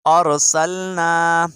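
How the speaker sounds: slow, drawn-out tilawat; measured Quran reading; Quran recitation. Shown as a demonstration. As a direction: recite it slowly and clearly for students. Reciting Surah Yasin.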